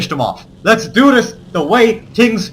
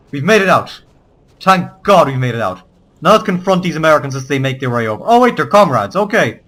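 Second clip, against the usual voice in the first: usual speaking voice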